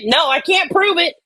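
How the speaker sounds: ordinary delivery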